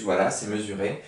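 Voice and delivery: ordinary talk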